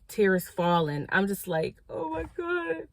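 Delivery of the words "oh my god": crying. Retold or imitated. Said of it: "I was bawling".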